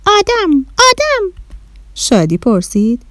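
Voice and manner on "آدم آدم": very high excited squeals; delighted alien. Acted. Little alien greeting the children, overjoyed and high. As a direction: bounce with glee at seeing humans. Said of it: "با خوشحالی گفت".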